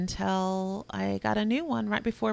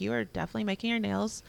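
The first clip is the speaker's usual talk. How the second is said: fast exasperated; 2 semitones higher, 3 dB louder than usual. Retold or imitated. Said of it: "I'm like".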